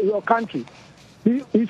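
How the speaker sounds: ordinary talk, nothing marked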